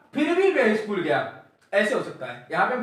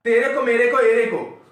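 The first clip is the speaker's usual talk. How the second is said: mock tapori voice, louder; mocking street slang; 2 semitones higher, 6 dB louder than usual. Shown as a demonstration. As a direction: ham up the chhapri slang. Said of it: "ये छपरी भाषा है।".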